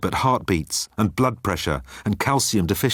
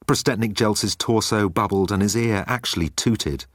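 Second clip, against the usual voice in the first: plain reading voice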